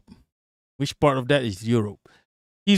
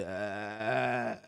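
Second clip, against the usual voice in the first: long, low, hushed creaky groan; horror ghost vocal fry; 5 semitones lower, 13 dB quieter than usual. Retold or imitated. Streamer imitating a horror movie ghost, creaky groan. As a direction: croak like a Japanese horror ghost. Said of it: "vocal fries is in a Japanese horror".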